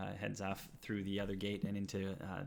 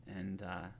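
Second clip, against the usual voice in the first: ordinary talk, nothing marked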